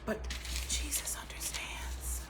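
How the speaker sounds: hushed and tender; reverent whisper. Real, not acted. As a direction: breathe the name softly. Reverent turn to Jesus.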